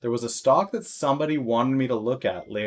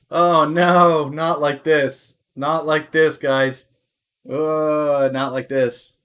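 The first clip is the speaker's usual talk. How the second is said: mock despair; 4 semitones higher, 7 dB louder, much slower than usual. Real, not acted. Streamer reacts to a stock drop.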